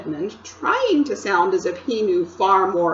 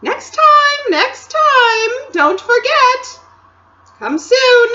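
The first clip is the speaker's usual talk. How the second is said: much higher, louder call; 12 dB louder, slower than usual. Acted. The Queen calling to Edmund from the sleigh, loud. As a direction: call after him from a sleigh. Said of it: "calling out".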